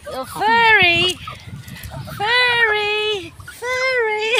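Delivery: slow, loud drawled furry. Real, not acted. Drawn out words to the animals.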